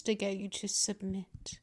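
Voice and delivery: soft menacing whisper. Acted. Mistress whispering to her pet about submitting. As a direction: hush the last words to a whisper. Threatening close to ear.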